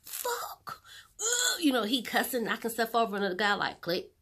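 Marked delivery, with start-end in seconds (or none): 0.1-0.8 strained, breathy cry of frustration; anguished curse. Retold or imitated. Man hearing his death news, anguished cry. 1.2-1.6 mock pained groan, pitched up; anguished groan. Man in the doctor's office groaning in despair. Continues the acted outburst.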